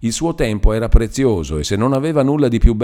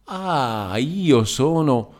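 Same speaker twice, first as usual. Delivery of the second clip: mocking sing-song; 6 semitones higher, much slower than usual. A narrator's reading. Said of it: "dondolare il capo".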